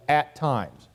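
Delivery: "at times" weighted and set apart; stress on "at times". Shown as a demonstration. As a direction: land firmly on "at times," dropping in pitch. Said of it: "now I emphasize".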